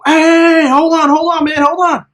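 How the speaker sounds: high, urgent calling out; re-enacted panicky "hold on" in a strained high pitch; alarmed protest. Backpedaling from kids surrounding him.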